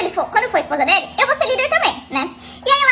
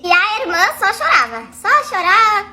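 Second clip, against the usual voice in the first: ordinary talk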